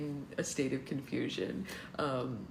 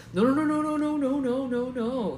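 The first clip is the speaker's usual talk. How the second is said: loud, high, hurried string of no's; quick no's; 9 semitones higher, 8 dB louder than usual. Acted. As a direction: blurt out the no's in a panic. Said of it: "he says quickly".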